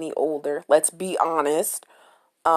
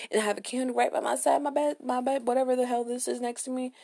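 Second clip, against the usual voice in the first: ordinary delivery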